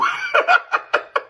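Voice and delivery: real laugh at own punchline; burst of laughter. Real, not acted. Laughing at own joke about the ego, hearty laugh. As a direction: crack up, high and breathless.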